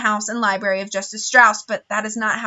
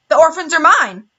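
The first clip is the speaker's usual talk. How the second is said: louder, higher insistence; insistent; 6 semitones higher, 6 dB louder than usual. A narrator's reading. Count Olaf insists on keeping the orphans, loud and higher. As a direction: claim them stubbornly. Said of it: "Count Olaf insisted".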